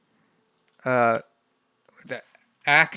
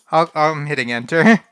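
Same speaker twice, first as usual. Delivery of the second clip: ordinary delivery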